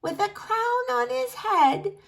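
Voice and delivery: ancient granny dreaming of her memoirs; proud old granny. Acted. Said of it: "she said".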